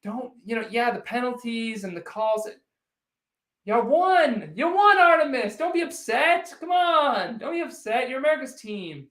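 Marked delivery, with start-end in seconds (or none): 3.7-9.1 higher, louder sing-song teasing; mock consoling. Streamer teasing a Cowboys fan in chat, high mock-consoling coo. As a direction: soothe him like a sulking kid, but mocking it.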